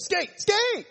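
high, loud shout to get a player's attention; coach calling out. Retold or imitated. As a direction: yell it across like an impatient coach. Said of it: "ever had to tell your players".

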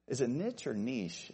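first "niche" as nitch, second "niche" as neesh; two pronunciations of niche. Shown as a demonstration. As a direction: contrast "niche" with short i against "niche" with long ee. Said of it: "I don't know".

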